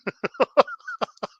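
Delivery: short high-pitched burst of giggles. Real, not acted. Host cracking up before sharing South Park news, high giggle.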